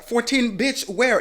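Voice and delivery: incredulous. Real, not acted. Mocking the mother's claim, high incredulous scoff. Disbelief at her age.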